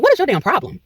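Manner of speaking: mildly indignant, pitched high; retold challenge, voice jumping up; indignant retort. Customer confronting the worker at the window, indignant.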